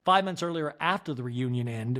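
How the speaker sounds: normal talking voice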